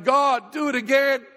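loud begging prayer, half-retold; retold tearful cry. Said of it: "I'm bawling my eyes out and I'm going".

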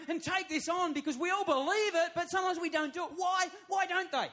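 frustrated pastor pleading with the church; building passion. Acted. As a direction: preach it with rising fire. Preacher building passionate questions.

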